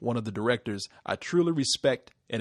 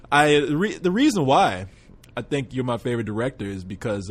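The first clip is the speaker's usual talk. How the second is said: ordinary talk, nothing marked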